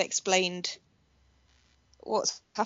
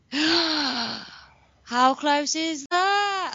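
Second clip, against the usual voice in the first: gasping, stunned at how close; gasp, then cry out in disbelief; shocked gasp; 5 semitones higher, 4 dB louder, much slower than usual. Real, not acted. Gasps at near miss.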